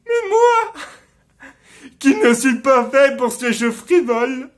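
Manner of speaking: loud, high-pitched theatrical tirade; impassioned stage rant, high and forceful, easing at the very end; bitter outburst. Acted. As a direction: cry out in wounded self-loathing. Acted bitter outburst about own deformity.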